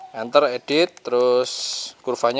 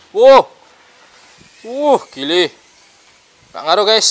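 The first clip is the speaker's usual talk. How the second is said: shouting over wind; 7 semitones higher, 7 dB louder, slower than usual. Real, not acted. Strong wind, flying drone outdoors.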